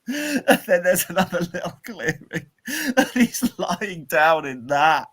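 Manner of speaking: high, laughter-filled storytelling; helpless giggles breaking into the story; laughing while telling. Real, not acted. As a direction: tell it barely holding back laughter.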